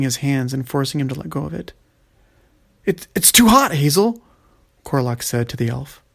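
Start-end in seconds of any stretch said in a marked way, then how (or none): 2.8-4.2 high, loud cry of pain; pained outcry. Acted. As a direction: yelp as the crystal burns. Crystal burning his hands.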